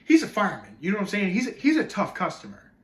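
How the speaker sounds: loud, high, exasperated argument; worked-up outrage with big pitch swings; indignant rant. Acted. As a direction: rail at the interviewer, punching key words. Acted indignant rant at Missus Oates.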